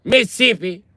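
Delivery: Mississippi squeezed to "Miss-sippi", pitched high; high mocking Mississippi with a syllable dropped; local pronunciation. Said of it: "but they say Mississippi".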